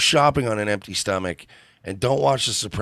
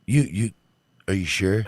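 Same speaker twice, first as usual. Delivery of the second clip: on the phone. Pretend. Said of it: "I'm on the phone with my son-in-law".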